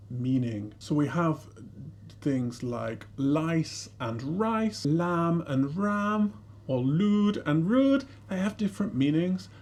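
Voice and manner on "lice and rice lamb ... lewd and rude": l and r words said very slowly; l versus r minimal pairs. Shown as a demonstration. Teacher showing how meanings change, slow l versus r pairs. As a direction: stretch each word to contrast initial l with r. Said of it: "the only difference between them is this l".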